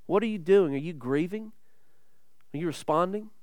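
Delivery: a pastor quietly challenging the congregation; soft searching questions. Acted. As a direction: press gently but pointedly.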